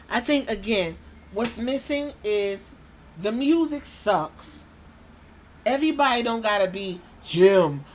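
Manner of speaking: usual speaking voice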